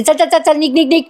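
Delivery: pushy imitated shout at the constable; retold yelling. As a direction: bark out the orders like a bossy madwoman. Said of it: "ओरडत असते तिच्यावर".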